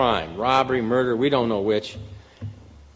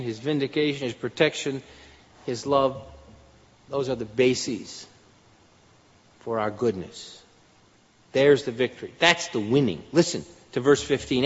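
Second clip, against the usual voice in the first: ordinary delivery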